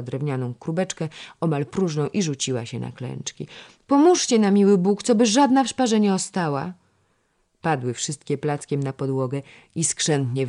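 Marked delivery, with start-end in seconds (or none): 3.9-6.7 high, loud urgent appeal; frantic plea. A narrator's reading. Girl begging help to gather spilled pearls, frantic. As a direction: beg everyone for help at once.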